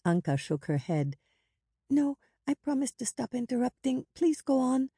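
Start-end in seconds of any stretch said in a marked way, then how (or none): 1.9-4.9 put-on high, earnest child's voice; high-pitched, coaxing younger sister; pleading little girl. A narrator's reading. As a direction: promise eagerly in a high little voice. Child promises to stop interrupting.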